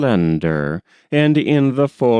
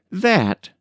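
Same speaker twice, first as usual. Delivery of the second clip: sharp interjection; 9 semitones higher than usual. A narrator's reading. Nat interrupts the toast.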